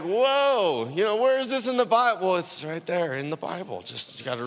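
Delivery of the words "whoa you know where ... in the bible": higher, mock-amazed whoa. Preacher voicing the church seeing glory, mock amazement. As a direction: act out stunned discovery. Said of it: "we're like".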